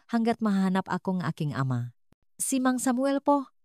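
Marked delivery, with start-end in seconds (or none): 2.4-3.5 higher-pitched, understated tearful question; between sobs. A narrator's reading. Frightened girl asks Aling Hazel after Mang Samuel, lightly tearful. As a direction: ask it with a touch of a sob, pitched up. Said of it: "sa pagitan ng mga hikbi".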